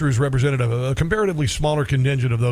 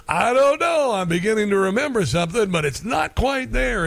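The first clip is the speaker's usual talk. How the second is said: high, coy hustler voice, slowing at the end; teasing Huggy Bear; 7 semitones higher than usual. Retold or imitated. Huggy Bear stalls the cops for another twenty, high and coy. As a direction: drag it out, fishing for more cash. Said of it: "Huggy takes the twenty and goes".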